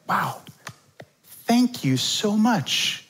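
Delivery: high, slow, sarcastic thanks; mock gratitude. A parent sarcastically thanking a complaining child. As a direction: drip with fake thanks. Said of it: "It's like".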